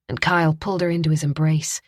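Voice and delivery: usual speaking voice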